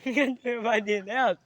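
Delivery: giggling through a hammed-up pitch; laughing mock ad. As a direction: try the commercial line but crack up. Repeats the ad line laughing.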